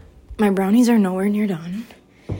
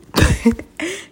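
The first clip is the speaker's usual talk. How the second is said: giggle; 9 semitones higher than usual. Real, not acted. Laughing at her own line.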